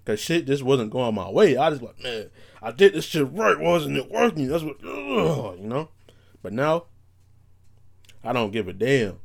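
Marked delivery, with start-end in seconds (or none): 2.0-5.6 exasperated rant ending in a growl; angry. Retold or imitated. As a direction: replay old frustration, slowing into a drawn-out groan. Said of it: "that's how angry I would be".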